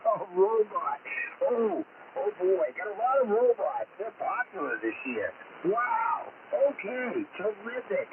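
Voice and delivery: jolly Santa voice. Acted. Santa on the radio greeting kids, jolly and hearty. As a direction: play a cheerful Santa over the radio to excited kids.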